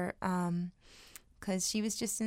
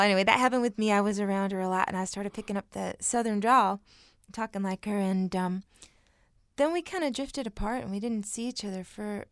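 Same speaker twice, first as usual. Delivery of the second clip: usual speaking voice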